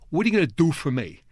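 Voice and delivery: quick New York-accented question; brash New York accent, a bit fast. Shown as a demonstration. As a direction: toss it off like a New Yorker. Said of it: "that's my New York accent".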